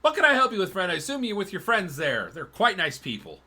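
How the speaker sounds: high, loud shopkeeper greeting; friendly tanner voice. Acted. Tanner greeting a customer, high and loud. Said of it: "looks at you and goes".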